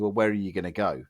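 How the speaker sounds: ordinary talk, nothing marked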